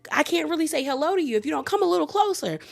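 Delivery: high, fast, sugary creepy coaxing; creepy man. Retold or imitated. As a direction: coax her closer like a sinister TV host. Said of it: "creepy man".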